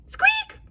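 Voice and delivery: tiny high squeak; high mouse squeak. Acted.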